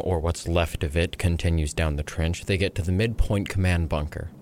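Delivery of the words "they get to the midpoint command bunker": ordinary delivery, nothing marked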